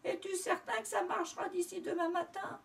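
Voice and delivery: storybook sobbing. A narrator's reading. Doudou to his brother as his tail hurts, mock sobbing. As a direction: play a piglet wailing in pain. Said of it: "il éclata en sanglots".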